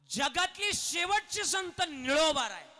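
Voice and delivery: slow, high, loud proclamation; full-force preaching. Acted. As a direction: shout it out to the crowd.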